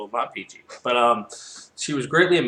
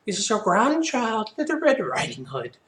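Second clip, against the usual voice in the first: counterfeit granddaughter voice; 11 semitones higher than usual. Acted. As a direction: fake being the grandchild, pitched way up. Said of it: "counterfeiting her voice, so I guess it was more like".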